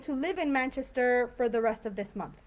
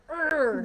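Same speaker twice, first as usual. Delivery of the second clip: a short annoyed "urgh" of frustration; frustrated groan; 5 semitones higher, 5 dB louder than usual. Shown as a demonstration. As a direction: groan like you're fed up with waiting. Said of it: "It's kind of more like that".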